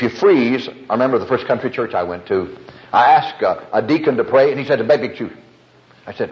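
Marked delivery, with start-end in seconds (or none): 4.8-5.3 garbled, rushed mumble run into one blur; slurred fast accent. Retold or imitated. Deacon turning down a request to pray, slurred and fast. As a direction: rattle it off so the words run together. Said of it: "you said it so fast".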